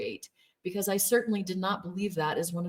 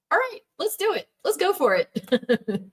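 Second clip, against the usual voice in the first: high, bright-voiced mock cheer; husband cheering her on; 10 semitones higher, 6 dB louder than usual. Husband cheering on wife's real estate plan, high and excited. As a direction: pump her up like a proud husband. Husband's quoted reply, enthusiastic.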